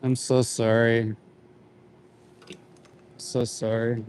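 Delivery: hushed sorry; sorrowful apology. Real, not acted. Apologising to animals in trucks.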